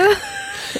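squealing high laugh. Real, not acted. Laughs at topic.

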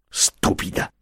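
whispered under the breath; muttered through teeth. A narrator's reading. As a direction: hiss it quietly through clenched teeth. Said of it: "mormorò Franco fra i denti".

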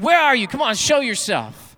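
high, loud and rattled; scared loud demand. Acted. Thief spins around and shouts at the hidden voice, loud and rattled. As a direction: demand it, nerves fraying.

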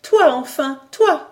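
un cri plus haut et plus fort, qui monte sur le second « toi »; l'appel éperdu de celle qui attendait; cri de joie. A narrator's reading. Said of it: "Un cri jaillit de ses lèvres". (Simone accueille Jacques d'un cri éperdu)

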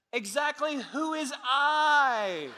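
high, loud mock shout; hammed-up yelled retort; screamed back. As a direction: holler back in comic exasperation, stretching the end. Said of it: "the disciple who screamed back".

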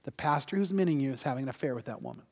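low, soft, quick divine message; voice from God. Retold or imitated. God answers the woman's question, low and soft. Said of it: "And it came again".

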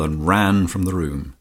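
ordinary delivery

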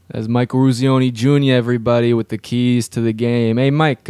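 loud, upbeat radio host voice; radio host intro. Acted. Host hyping the keys guy to listeners, loud radio voice. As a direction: boom it out like a sports-radio host.

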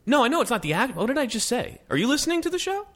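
high, indignant protest; exasperated. Real, not acted. Annoyed back at cohost.

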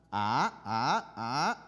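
each "ah" sliding upward; three "ah"s climbing like a car uphill; rising tone. Shown as a demonstration. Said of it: "when he goes uphill".

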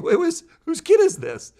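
very high, loud, laughing squeal; amused disbelief. Acted. Adult laughing off a two-year-old's challenge, incredulous. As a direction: crack up in mock incredulity.

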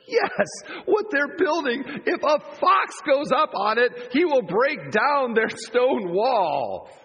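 high, jeering sneer; mocking taunt. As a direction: sneer it out to the crowd. Tobiah's taunt, emphasis added.

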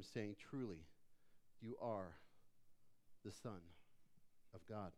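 low, hushed reading with reverent pauses; scripture reading. A narrator's reading. Preacher reads of disciples worshipping Jesus, low scripture reading. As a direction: read it low and soft, letting each phrase settle. Reads the Bible passage aloud.

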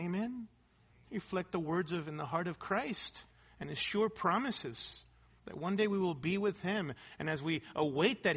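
usual speaking voice

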